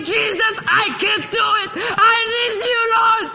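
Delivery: high, loud crying plea; desperate cry. Shown as a demonstration. Preacher demonstrating a prayer, crying out. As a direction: show them how to cry out. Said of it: "You must be like".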